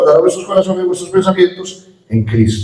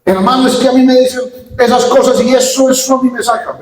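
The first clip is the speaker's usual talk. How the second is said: higher, complaining church brother; upset brother; 6 semitones higher than usual. Retold or imitated. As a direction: whine like the irritated brother. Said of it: "me decía". (hermano quejándose, agudo)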